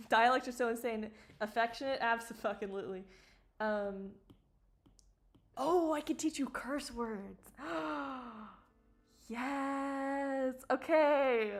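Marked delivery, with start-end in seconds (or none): 5.6-11.5 delighted. Real, not acted. Excited at teaching curse words.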